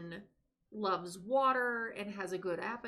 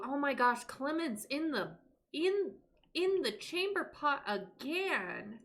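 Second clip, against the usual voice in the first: oh my gosh, not again, getting higher; exasperated; 5 semitones higher than usual. Real, not acted. She's playing in chamber pot again.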